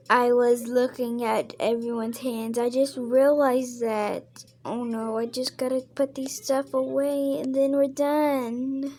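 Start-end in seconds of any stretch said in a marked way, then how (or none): none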